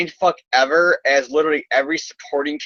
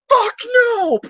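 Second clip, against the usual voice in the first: mock scream; 3 dB louder than usual. Peter breaking his restraints.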